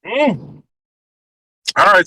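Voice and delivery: usual speaking voice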